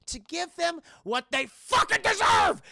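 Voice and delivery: hammed-up villain threat; mock villain shout. Mother promising revenge on bullies, mock shout.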